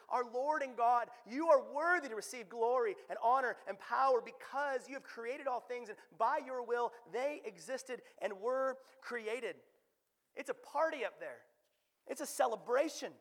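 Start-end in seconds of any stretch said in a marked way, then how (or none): 0.1-9.6 proclaimed praise. Acted. Preacher voicing the elders' praise to God, loud proclamation. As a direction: proclaim it as fervent worship. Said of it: "the, the elders".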